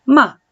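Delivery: MA high, loud, falling fast; fourth tone. Shown as a demonstration. Teacher showing fourth tone to student, MA falling. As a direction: drop MA sharply. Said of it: "Y el cuarto tono".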